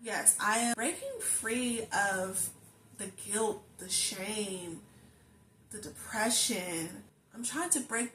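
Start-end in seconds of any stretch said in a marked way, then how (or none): none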